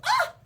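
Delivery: very high, loud startled "ah"; startled shriek. Acted. Little T surprised by dad, acted startled shriek. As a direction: squeal as if jumped out at. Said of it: "was to surprise them".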